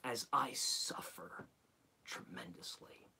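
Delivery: weak, whispered lament; drained, barely voiced whisper; suffering whisper. Mock suffering from a cold.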